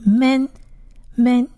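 slow, separated "men", said twice; "men" sounded out for learners; Hebrew letter name. Shown as a demonstration. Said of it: "第二个字母是men".

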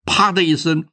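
plain reading voice

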